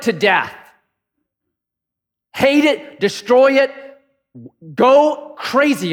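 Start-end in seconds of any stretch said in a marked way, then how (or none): 2.3-5.9 fierce urging. Acted. Preacher urging to kill sin.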